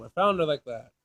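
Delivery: normal talking voice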